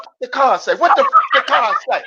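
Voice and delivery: high, loud demanding yell; mock rage, grabbing for the card; furious sister. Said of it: "She said".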